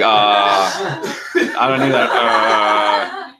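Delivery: long drawn-out, comic "uh" of dread; hammed-up scared groaning, stretched out; mock freak-out. Telling of a possible ghost dad, mock freak-out.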